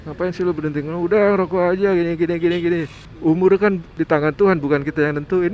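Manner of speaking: mocking, coaxing friend's voice; teasing friends. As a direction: needle him to light up again. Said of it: "teman-teman saya yang masih ngerokok ngeledekin saya".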